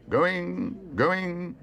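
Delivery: high, chanting auctioneer; auctioneer's call. A narrator's reading. Auctioneer closing the bidding at Paddington, calling out. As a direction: sing it out as the hammer hovers. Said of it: "called the auctioneer".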